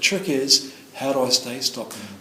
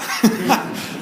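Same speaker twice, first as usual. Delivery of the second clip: short laugh; 9 semitones higher than usual. Real, not acted. Laughing at the half-hour talk.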